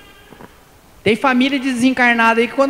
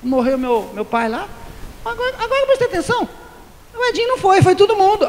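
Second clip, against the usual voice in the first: higher, indignant complaint about who missed the funeral; offended relative; 6 semitones higher than usual. Retold or imitated. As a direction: call out the absent friend, hurt. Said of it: "bate o olho em você, fala".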